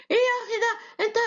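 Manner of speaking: very high agitated squawk; mock quarrel, pitch shooting up; excited high pitch. Shown as a demonstration. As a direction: demonstrate getting worked up in a very high voice. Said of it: "When we are excited، ال pitch rises".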